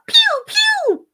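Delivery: high, loud cartoon laser noises; laser pew pew. Blasting laser sounds for a fan, high and loud. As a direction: fire off squeaky blaster sounds. Sound effect after name.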